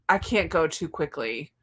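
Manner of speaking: ordinary delivery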